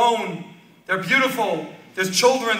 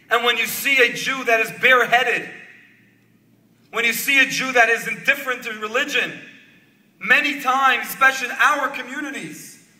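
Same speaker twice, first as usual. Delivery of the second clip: raised, exhorting sermon delivery; impassioned preaching; 5 semitones higher, 4 dB louder than usual. Real, not acted. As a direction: press the moral on the listeners, emphatically.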